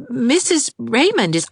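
high, hurried start of an apology; flustered young governess. A narrator's reading. Governess cut off by an indignant neighbour, flustered. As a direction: caught off guard, answering too quickly.

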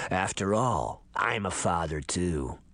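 low, creaky ancient man. Acted. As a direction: creak it out like a parched old man. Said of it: "the voice crackled".

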